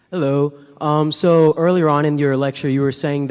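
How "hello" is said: ordinary talk, nothing marked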